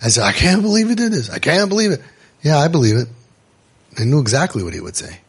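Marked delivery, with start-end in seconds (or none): 0.2-2.0 higher, fast disbelief; mock shock. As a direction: play the shocked parent. Parents shocked again.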